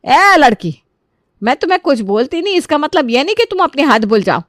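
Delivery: indignant mother-in-law telling off; scolding. A narrator's reading. Mother-in-law berates her son's wife over her daughter, scolding. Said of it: "खरी खोटी सुनाने लगते हैं".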